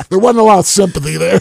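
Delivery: laughing, high and loud, a bit fast. Real, not acted. Telling the host about his friends' lack of sympathy, laughing. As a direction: push it out through laughter.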